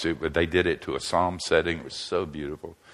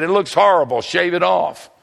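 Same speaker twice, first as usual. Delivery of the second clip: blunt demand; 11 semitones higher, 7 dB louder than usual. Retold or imitated. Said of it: "She said".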